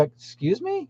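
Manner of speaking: mock-shocked question to the girl; incredulous. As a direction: retell your own stunned reaction. Shocked reply to the worker.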